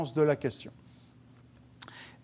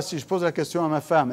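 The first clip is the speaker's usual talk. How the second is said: ordinary talk, nothing marked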